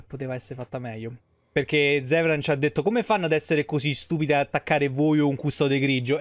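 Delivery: ordinary talk, nothing marked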